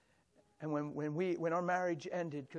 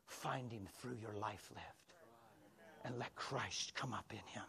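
hushed counsel; 4 semitones lower, 11 dB quieter than usual. Retold or imitated. Pastor counsels tenderly.

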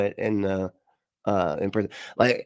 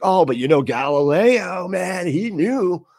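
higher, slowed smug crackpot voice; mock pseudoscientist; 7 semitones higher than usual. Pseudoscientists citing Galileo, in a smug mocking voice. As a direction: sell Galileo like a smug know-it-all. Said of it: "They're always like".

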